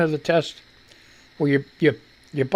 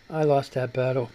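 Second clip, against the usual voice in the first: "I lost that battle" said lower and softer, trailing off; resigned, softer; 6 semitones lower, 5 dB quieter than usual. Real, not acted. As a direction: let "I lost that battle" sink, giving up. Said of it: "repeated, softer".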